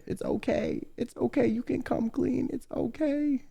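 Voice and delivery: soft, high, soothing coo; hushed, high-pitched comforting voice; gentle mock reassurance. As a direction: comfort them as you would a small child. Mock-comforting the guilty confessor.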